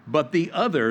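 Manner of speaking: ordinary talk, nothing marked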